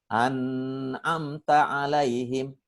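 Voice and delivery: slow "An'amta 'alaihim" with a long hummed "n"; wrongly added ghunnah. Retold or imitated. Ustadz imitating someone he tested, wrongly humming the "n" in "An'amta". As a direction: hold the "n" of "An'amta" nasally. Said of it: "kenapa dibaca gunnah?".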